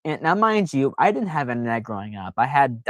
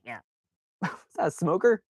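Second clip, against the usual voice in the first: ordinary talk, nothing marked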